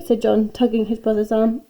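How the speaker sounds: ordinary delivery, nothing marked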